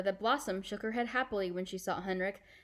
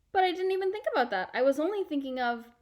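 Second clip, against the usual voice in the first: put-on high, fast, louder girl's protest; eager protest; 8 semitones higher, 5 dB louder, faster than usual. Anne-Marie protesting to her uncle, high and hurried. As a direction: rush out the objection like a child.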